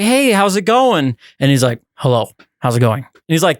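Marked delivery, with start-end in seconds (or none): 0.0-1.2 higher, drawn-out friendly hello; acted upbeat greeting, pitched up. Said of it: "You're like". 2.0-3.0 low, subdued, unreadable hello; lower-pitched, reserved mystery-man greeting; shy stranger. Acted. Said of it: "He's very shy".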